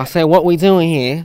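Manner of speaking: mock British repeat of the question; British accent. Repeating his question to his friend in a put-on British accent. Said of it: "Do a British accent for the rest of the video".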